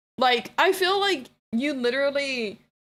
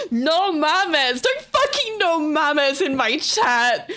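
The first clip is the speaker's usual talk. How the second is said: loud, higher laughing protest; laughing shout; 5 semitones higher, 4 dB louder, much slower than usual. Real, not acted. Streamer to chat, laughing shout. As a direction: yell it while cracking up.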